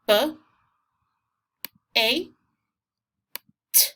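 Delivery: b, a, t as three separate sounds with long pauses; isolated phonics sounds b, a, t; sounding out. Shown as a demonstration. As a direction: say each sound of "bait" alone: b, long a, t. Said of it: "Remember to sound this out".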